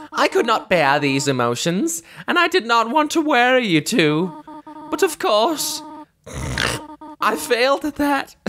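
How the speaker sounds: lightly tearful motherly figure, formal phrasing; tearful game character. Acted. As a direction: apologize through held-back tears. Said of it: "Of course she was crying".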